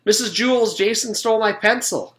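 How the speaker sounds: loud high tattling call. A narrator's reading. Rondi calling out to the teacher, loud and high. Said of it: "called Rondi".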